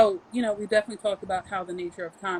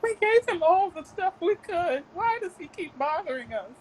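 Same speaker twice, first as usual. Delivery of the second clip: IRS agent voice; 8 semitones higher than usual. Pretend. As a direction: whine like a fed-up tax office. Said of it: "the I R S is like".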